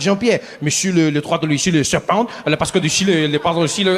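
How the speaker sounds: babbled fake French; mock French chatter. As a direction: prattle to your son in pretend French. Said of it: "He turns to his son".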